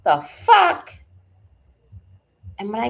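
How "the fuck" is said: high, loud outburst; shocked. Real, not acted. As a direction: blurt it out in shock. Shocked reaction.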